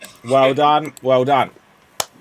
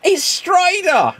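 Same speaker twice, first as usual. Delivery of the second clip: mock Pippin; 13 semitones higher, slower than usual. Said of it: "Pippin's like".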